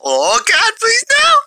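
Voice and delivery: high, loud mock shriek of terror; mock terrified. Gamer pleading with an enemy in a hammed-up shriek. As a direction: scream for mercy like a horror victim. About to die in game.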